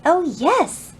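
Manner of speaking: bright, pleased exclamation; delighted with the finished picture; happy. Acted. Peter admiring his picture on the wall, happy. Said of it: "said Peter happily".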